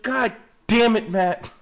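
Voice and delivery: host scolding Matt for drinking before driving; exasperated. Real, not acted. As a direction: drag it out, exasperated. Exasperated at drunk friend.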